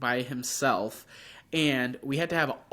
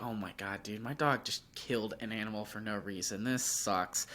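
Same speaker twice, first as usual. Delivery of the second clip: softer, deflated lament; retold dismay; 5 semitones lower, 10 dB quieter than usual. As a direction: mumble it like you can't bear it. Retold dismay at dead animal.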